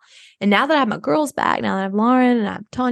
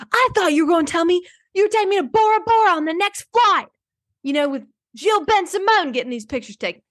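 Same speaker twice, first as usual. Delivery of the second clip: bratty rant; 9 semitones higher, faster than usual. Retold or imitated. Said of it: "He's like".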